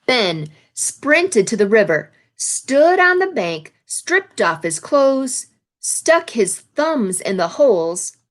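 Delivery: storybook read-aloud, lively phrasing; picture-book narration; reading to children. A narrator's reading. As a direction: read the page to the class.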